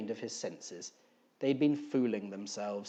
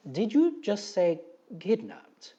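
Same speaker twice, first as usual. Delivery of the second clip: incredulous; 7 semitones higher, 5 dB louder, slower than usual. Acted. Gribley's disbelieving question.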